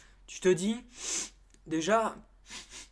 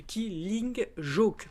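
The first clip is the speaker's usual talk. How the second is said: mock Italian accent; 4 semitones higher, much slower than usual. Shown as a demonstration. Correcting the band name in a mock Italian accent. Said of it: "je fais cet accent italien de merde".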